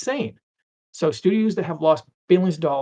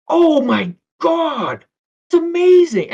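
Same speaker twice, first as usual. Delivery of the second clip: much higher, louder, slow drawn-out gasp of amazement; amazed; 13 semitones higher, 9 dB louder, slower than usual. Retold or imitated. Speaker reacting to learning Darlie was Dave, high loud amazement.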